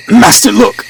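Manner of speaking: high-pitched alert; urgent call. A narrator's reading. Servant spots the goat and alerts master, high urgent call. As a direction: call out high to point something out.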